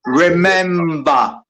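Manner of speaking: "remember." drawn out slowly with a clear M; slow correct pronunciation. Shown as a demonstration. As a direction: spell out "remember." slowly, as if teaching. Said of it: "Remember is with an M and not a N".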